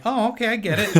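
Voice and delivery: high, loud delighted realization; light up as the joke lands; mock delighted parent; parent catching joke.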